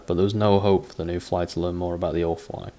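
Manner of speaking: ordinary talk, nothing marked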